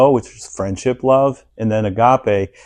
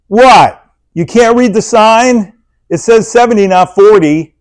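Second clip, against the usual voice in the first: much higher, louder mock yell at another driver; road rage rant; 10 semitones higher, 9 dB louder than usual. [name] yelling at another driver on the road, mock angry. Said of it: "That's patient [name]".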